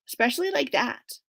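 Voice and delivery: ordinary delivery, nothing marked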